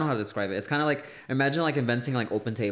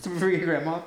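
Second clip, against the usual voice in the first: amused disbelief; 7 semitones higher than usual. Real, not acted. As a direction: repeat it like you can't believe it. Repeating the insult in disbelief.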